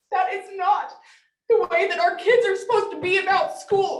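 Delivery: fighting back tears as she speaks; near tears. Real, not acted. Mother telling the school board about her daughter, close to crying. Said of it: "I'm gonna cry because".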